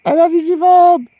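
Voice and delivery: thrilled, loud thanks; excited shout-out. Real, not acted. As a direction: beam it out to the fan. Thanking a viewer on stream.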